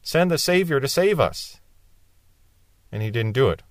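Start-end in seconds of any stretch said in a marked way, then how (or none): 0.0-1.6 mock desperate cry. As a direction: act out crying to heaven for rescue. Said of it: "they cried out".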